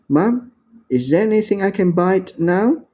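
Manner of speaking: ordinary talk, nothing marked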